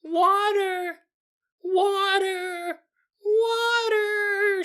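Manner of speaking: put-on very high drawn-out toddler wail; squeaky, stretched-out little kid cries. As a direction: cry out like a distressed three-year-old. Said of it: "do one of your little kid voices".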